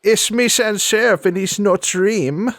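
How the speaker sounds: a hint of a theatrical ghostly lady; Sans Serif voice. Player voices Sans Serif character.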